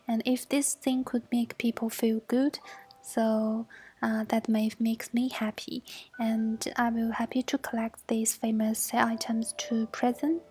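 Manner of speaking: usual speaking voice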